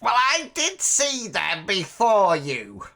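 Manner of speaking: high, crackling crone voice; high-pitched old woman staking her claim; old hag. Acted. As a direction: protest like a toothless old hag. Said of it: "this toothless hag of a woman".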